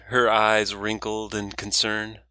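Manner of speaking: usual speaking voice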